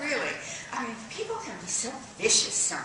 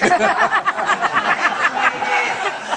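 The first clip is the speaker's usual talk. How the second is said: loud giddy squeal of laughter; excited high cackle; high shrieking laugh; 11 semitones higher, 14 dB louder than usual. Acted. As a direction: shriek with delight. Offering cigarettes giddily.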